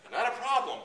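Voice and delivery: high, loud, laughing through the words; cracking up as you reassure him. Real, not acted. Laughing reply to fugitive.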